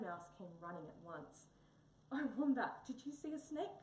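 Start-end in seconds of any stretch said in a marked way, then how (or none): 2.1-3.8 higher, louder startled gasp. Acted. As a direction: gasp it out, alarmed for Wombat. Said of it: "gasped little Mouse".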